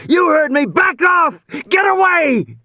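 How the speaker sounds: high, loud yelling at the beast; defiant shout. A narrator's reading. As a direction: scream him down like he's beaten it. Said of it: "Regis was shouting".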